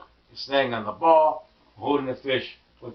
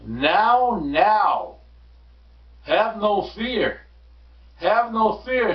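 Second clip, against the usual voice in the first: higher, slow reassuring showman; cat character voice; 7 semitones higher, much slower than usual. Acted. Cat reassuring the worried fish, slow and raised up high. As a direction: soothe the kids like a grand magician. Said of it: "said the cat".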